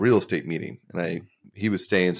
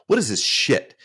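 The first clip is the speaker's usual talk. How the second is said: higher-pitched, put-on outrage at a drink; mock disgust; 7 semitones higher, 4 dB louder than usual. Friend prank-complaining about the scotch he was served, mock disgust. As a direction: imitate an appalled, picky drinker.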